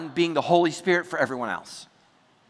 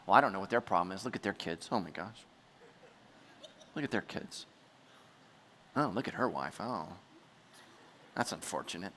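very low, hushed judgmental aside; unspoken judgmental thoughts; 9 semitones lower, 12 dB quieter than usual. Acted. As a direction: murmur it like a thought kept private. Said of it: "you don't say those things out loud".

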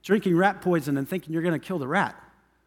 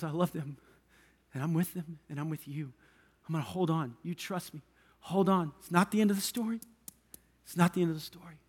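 much softer, gentle promise; tender reassurance; 4 semitones lower, 11 dB quieter than usual. Retold or imitated. Jesus comforting worried parents, softly and tenderly. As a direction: hold them close with your voice.

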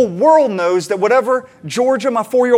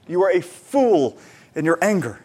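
ordinary talk, nothing marked

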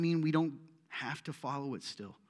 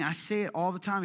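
ordinary delivery

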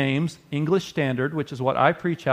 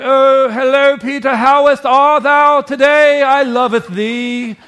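high, slow, loud mock British film Jesus; 9 semitones higher, 8 dB louder, slower than usual. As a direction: ham up an old-movie Jesus with a posh English lilt. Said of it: "Jesus is all like".

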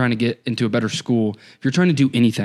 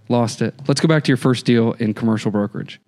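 ordinary talk, nothing marked

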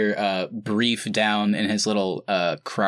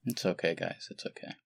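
softer and fading, gently reassuring; 2 semitones lower, 10 dB quieter than usual. Real, not acted. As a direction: comfort the team, letting it trail away. Comforting embarrassed teammates.

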